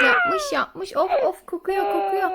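high, loud pretend horror; mock disgust. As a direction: groan theatrically at a smelly nappy. Mock disgust at smelly nappy.

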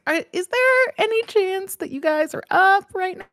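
fake casual. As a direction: play it breezy and cool while panicking. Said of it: "Just being really cool".

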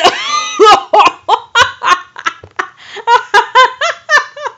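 long, high, loud laughing fit; helpless laughter. Real, not acted. Reader losing it over the cat spell, helpless laughter. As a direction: crack up completely, shrieking with laughter. Cracks up at the story.

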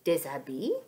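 mildly alarmed question; a touch of startled disbelief; exclaiming. A narrator's reading. Sacha hearing about bees, mildly exclaiming. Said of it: "s'exclame Sacha".

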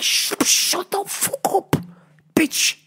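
harsh, slow stage whisper; mock-angry whispered shushing; hissed hushing. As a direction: hiss it slowly across the theater. Hushing the chatty ladies.

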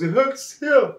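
high-pitched pained whimper; acted crying. Elephant showing Mister Mouse his hoof, mock tearful. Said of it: "he's crying".

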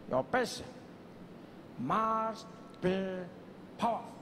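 long pauses between phrases; weighty and deliberate. Acted. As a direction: let each phrase land before the next. Mandela models weighty delivery, long pauses.

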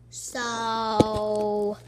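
long, held-out loud goodbye; big drawn-out shouted sign-off. Real, not acted. Signing off the video.

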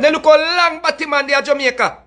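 ordinary delivery

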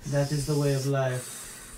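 low, slow French deadpan; blasé French accent, dragging it out. Acted. French operator answering a dying caller, very French. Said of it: "in the most French way".

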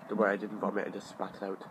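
ordinary talk

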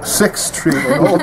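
slow, tongue held down, laughing; holding tongue with fingers. Real, not acted. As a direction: stumble through it with the tongue pinned. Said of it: "That's wrong".

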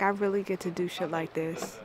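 ordinary talk

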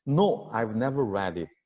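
"read it" linked as reh-dit at the end of the sentence; linked pronunciation. Shown as a demonstration. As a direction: model the sentence slowly with "read it" joined. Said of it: "이렇게 발음해요".

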